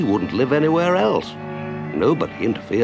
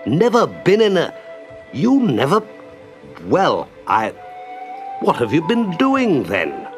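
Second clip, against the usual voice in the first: open-mouthed astonishment; 7 semitones higher than usual. A narrator's reading. Rat questioning Mole who never boated, flabbergasted. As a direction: stammer out broken phrases in amazement. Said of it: "open-mouthed".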